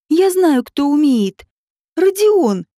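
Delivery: light up, she has the answer; joyful exclamation. A narrator's reading. Writer joyfully names Rodion to Slava, lightly excited. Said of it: "радостно воскликнула та".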